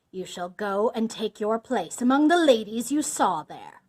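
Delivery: loud, forceful villain passing sentence; booming accusation; angry condemnation. A narrator's reading. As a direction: pronounce the doom with rising force. Bluebeard condemns his wife.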